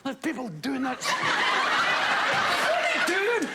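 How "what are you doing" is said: drawn-out high incredulous laughing question; incredulous mock. Mocking ballroom dancers, drawn-out incredulous laughing question. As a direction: stretch it out in mock disbelief.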